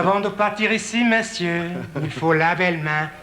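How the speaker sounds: lilting mock French with a savouring touch. Comedian repeating the Paris sign, savouring the mock French. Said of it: "Isn't that pretty?".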